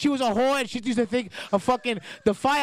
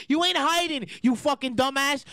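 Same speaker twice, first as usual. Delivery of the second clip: ordinary talk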